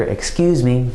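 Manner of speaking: normal talking voice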